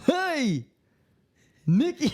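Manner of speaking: high Goofy "Hey, Mickey"; Goofy impression. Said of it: "You sound like a fuck".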